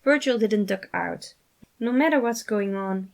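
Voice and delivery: ordinary delivery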